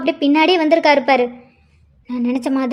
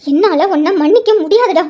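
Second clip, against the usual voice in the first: loud, raised-pitch outburst; angry refusal; 4 semitones higher, 5 dB louder than usual. Acted. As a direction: refuse him forcefully, voice up. Wife refuses to forgive husband. (கோபமாகக் குரல் உயர்த்தி மறுப்பு)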